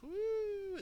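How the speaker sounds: high, long ghostly wooo; spooky woo. Retold or imitated. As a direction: imitate a spooky howl. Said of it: "kinda a little spooky".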